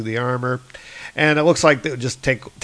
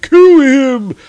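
much higher, louder slow battle yell; giants shouting; 10 semitones higher, 8 dB louder, much slower than usual. Acted. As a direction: scream for the kill like a mob. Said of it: "It's like".

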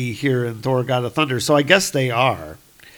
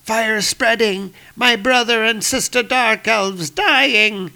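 panicked shout; 8 semitones higher than usual. Acted. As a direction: cry out in terror of fire. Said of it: "and he's shouting".

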